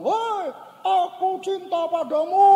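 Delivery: very high, slow holler; stretched, high-pitched call into the distance; child calling out. Retold or imitated. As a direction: imitate a kid calling love across a ravine. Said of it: "Lalu si anak bilang".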